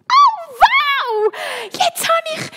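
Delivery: very high, loud delighted shriek; excited screaming. A narrator's reading. Malvine finds her long-lost book, screaming with excitement. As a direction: scream it out in amazement. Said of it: "schreit Malvine".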